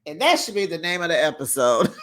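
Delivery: ordinary talk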